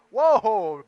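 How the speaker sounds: loud sudden whoa at the wave; a loud burst of real excitement; excited whoa. Real, not acted. Big move surfed live.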